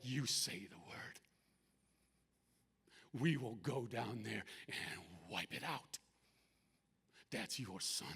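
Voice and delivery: lightly voiced angel, low and slightly softened; low, measured readiness to strike; warrior angel, restrained. Warrior angel awaits God's order.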